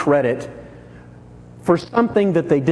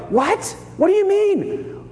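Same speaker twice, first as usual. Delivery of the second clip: shocked what; 11 semitones higher than usual. Retold or imitated. Goats shocked at judgment.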